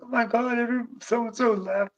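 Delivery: high, breathless imitation of a woman rushing out with news; excited woman. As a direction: mimic her hurried, excited gush. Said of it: "She's like".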